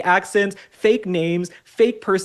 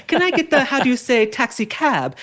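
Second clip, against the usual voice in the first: higher, mock French accent; put-on French accent, pitched up; French boyfriend; 6 semitones higher than usual. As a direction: imitate a Frenchman groping for an English word. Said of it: "this French guy I dated".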